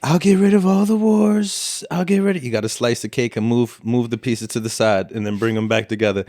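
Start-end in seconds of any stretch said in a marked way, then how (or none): 0.0-2.4 Trump impression. Mocking his end-of-campaign promises, in a put-on politician's voice. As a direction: imitate a politician making sweeping promises. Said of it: "It's a pretty good Trump impression".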